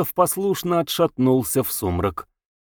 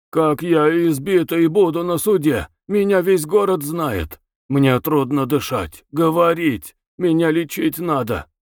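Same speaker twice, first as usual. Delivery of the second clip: a higher, frantic whine from a battered man; beaten prisoner complaining; 6 semitones higher than usual. A narrator's reading. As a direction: plead and protest in panic. Bezbedov pleads in panic. (избитый арестант, жалобно и испуганно)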